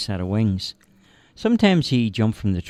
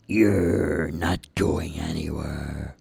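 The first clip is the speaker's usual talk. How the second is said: lower, slow threatening voice; menacing dark being; 5 semitones lower, much slower than usual. Acted. Dark being blocks their way, low and slow. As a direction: drag out the threat.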